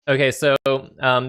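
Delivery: ordinary talk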